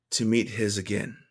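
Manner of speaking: normal talking voice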